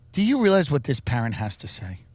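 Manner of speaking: normal talking voice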